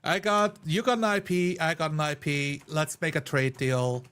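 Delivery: ordinary talk, nothing marked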